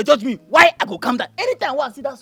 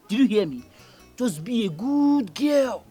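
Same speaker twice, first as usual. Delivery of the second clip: gentle coaxing; slower than usual. Acted. Soothing the girl.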